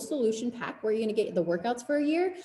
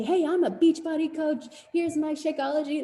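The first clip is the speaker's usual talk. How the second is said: a parody of a pushy coach pitching her product; pushy sales pitch; 8 semitones higher than usual. Retold or imitated. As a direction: ham up the eager seller pushing product. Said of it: "you don't have to say".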